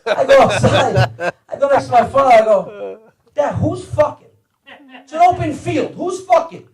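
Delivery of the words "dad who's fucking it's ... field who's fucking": high-pitched, mock bewildered questioning; baffled son. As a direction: demand an explanation in a high voice. Baffled son questions his father.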